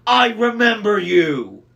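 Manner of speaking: slow, loud, high furious bellow; enraged Cyclops. A narrator's reading. Cyclops rages at his old enemy. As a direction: roar each word at the old enemy.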